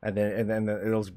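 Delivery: normal talking voice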